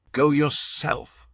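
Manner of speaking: high, slow scornful reply; defiant retort. A narrator's reading. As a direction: throw it back at him slowly. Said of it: "said she".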